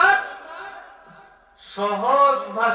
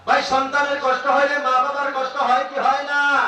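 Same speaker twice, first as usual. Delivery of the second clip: higher, louder repeated question; raised appeal; 9 semitones higher than usual. Shown as a demonstration. Preacher repeats question to the crowd, louder appeal. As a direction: press the crowd for an answer. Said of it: "ভাই, repeat louder".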